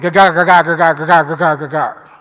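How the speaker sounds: loud, mock grumbling gagar, gagar; grumbling sound word. Shown as a demonstration. Preacher imitates the crowd grumbling, loud and mocking. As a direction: growl out the gagar like a muttering crowd. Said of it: "it almost sounds like it".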